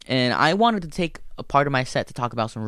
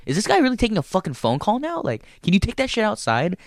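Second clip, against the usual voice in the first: exasperated thought; 9 semitones higher than usual. Retold or imitated. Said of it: "is what I wanted to say".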